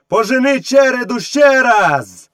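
high, drawn-out command; shouting. A narrator's reading. Boy sending the wolf after the herd, shouting. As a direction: call the order out slowly and high. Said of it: "крикнув Мауглі".

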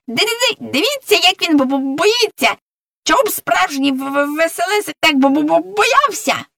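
high, excited stuttering hare; stammering cry. Acted. Hare tells everyone he is afraid, high stammering cry. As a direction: stutter hard on each key word. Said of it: "закричав заєць Сергій".

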